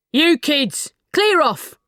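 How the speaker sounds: loud, high gruff order; builders ordering. Acted. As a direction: shoo the kids away. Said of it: "the builders told them".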